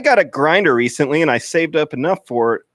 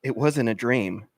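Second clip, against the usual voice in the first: much lower and softer, sinister; creepy low reveal; 7 semitones lower, 7 dB quieter than usual. Acted. Steve creepily tells Marvin it wasn't a dream, low and soft. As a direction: let the secret creep out low.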